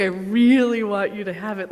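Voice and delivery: loud sincere urging; earnest insistence. Retold or imitated. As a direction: press her warmly but firmly. Finally convincing her to take it.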